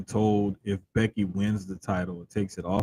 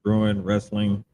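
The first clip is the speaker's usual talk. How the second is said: robot voice. Retold or imitated. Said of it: "Robotic John Cena".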